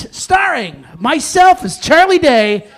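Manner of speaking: ordinary talk, nothing marked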